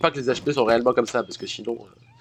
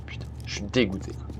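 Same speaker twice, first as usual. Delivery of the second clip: whispered disgust; fed-up whispered curse; frustrated whisper; 3 semitones lower, 9 dB quieter than usual. Real, not acted. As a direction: hiss it out under your breath, fed up. Frustrated at his bad connection.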